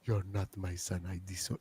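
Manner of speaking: very low, soft mock-solemn accent; Kofi's mom. Encore impression for the chat, low grave mother's accent. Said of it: "Kofi's mom impersonation".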